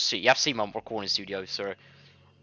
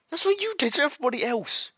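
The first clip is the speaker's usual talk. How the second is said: heroine's outburst. Acted. The betrayed heroine crying out at the cruel player. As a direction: burst out at him in fury.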